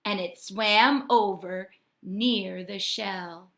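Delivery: ordinary delivery, nothing marked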